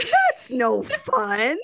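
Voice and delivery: slow laughing complaint. Real, not acted. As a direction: whine it through a laugh.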